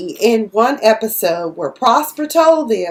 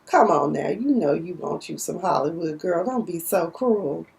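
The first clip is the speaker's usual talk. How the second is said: teasing coax; 5 semitones lower, 6 dB quieter than usual. Retold or imitated. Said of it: "I was like".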